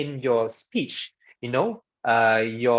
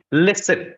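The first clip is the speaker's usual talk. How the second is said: "Listen" punched higher, then silence; dramatic pause; 4 semitones higher, 4 dB louder than usual. Shown as a demonstration. Teacher showing dramatic effect, emphatic "Listen" then silence. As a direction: hit "Listen" and hold a long pause after. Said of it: "longer pause for dramatic effect".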